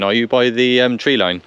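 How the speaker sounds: normal talking voice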